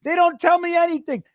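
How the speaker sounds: mock shout about the show; mock whiny shout. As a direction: wail like a betrayed fan. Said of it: "they go".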